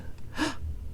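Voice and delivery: soft unvoiced gasp; a hushed surprised intake of breath; mock gasp. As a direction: pretend surprised gasp for the kids. Reader reacts to costume reveal.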